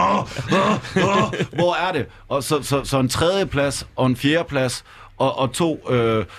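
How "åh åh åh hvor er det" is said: higher-pitched mock groans of craving; desperate craving. Fan hunting his childhood high, mock desperate. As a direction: play up a desperate hunt for a fix. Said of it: "rendt rundt og været sådan".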